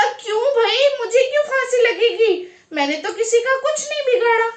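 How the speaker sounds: high-pitched, whining protest; indignant protest. Acted. Narayan Das asks soldiers why he must hang, indignant protest. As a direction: protest your innocence, pitched well up. Seized man protests his hanging.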